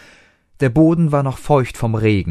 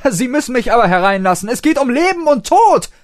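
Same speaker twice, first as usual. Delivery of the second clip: high, loud, fast insistence; urgent demand; 7 dB louder than usual. A narrator's reading. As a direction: push past the bouncers urgently. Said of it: "forderte der Doktor". (fordernd und dringlich)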